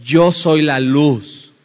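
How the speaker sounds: loud, slow proclamation of light; shouting. Acted. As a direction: call it out slowly so all hear. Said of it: "está gritando". (grito lento y fuerte)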